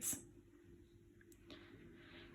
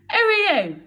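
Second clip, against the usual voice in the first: startled demand; 13 semitones higher, 10 dB louder than usual. Acted. Bill confronts intruder.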